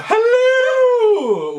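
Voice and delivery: high, loud falsetto Mrs. Doubtfire hello; Mrs. Doubtfire impression. Imitating Mrs. Doubtfire for his wife on camera, loud falsetto. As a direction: squeal it like a nanny greeting. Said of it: "my Missus Doubtfire impression".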